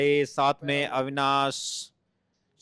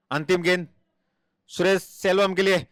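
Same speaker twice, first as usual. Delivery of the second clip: usual speaking voice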